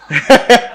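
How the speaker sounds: brief high chuckle; a quick burst of amusement; short laugh. Real, not acted. Teacher tickled by Talab being first, short chuckle. Laughs at student's name joke.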